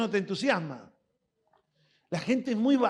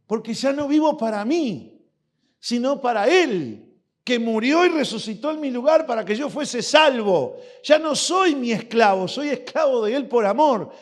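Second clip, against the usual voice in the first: raised, loud preaching; fervent preaching; 3 semitones higher, 6 dB louder than usual. Acted. Preacher proclaims to his church, loud and fervent. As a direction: proclaim it to the congregation.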